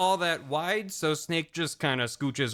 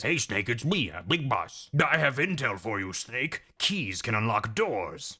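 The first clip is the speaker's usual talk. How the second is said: low commander radio voice; hammed-up low military chief; Big Boss; 5 semitones lower than usual. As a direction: give obvious intel as a deep-voiced boss. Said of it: "Big Boss calls and says".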